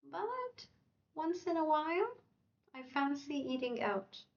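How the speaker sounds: But jumps up high, then the pitch settles on "I fancy eating out"; a lively contrast lift on "But once in a while"; contrasting intonation. Shown as a demonstration. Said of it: "By the way, intonation does a lot".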